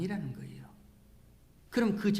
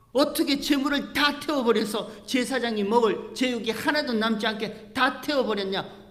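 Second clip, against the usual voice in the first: higher, louder scolding outburst; angry rebuke; 7 semitones higher, 7 dB louder than usual. Retold or imitated. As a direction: rebuke the priests as angry Moses. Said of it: "화를 내는 장면이에요".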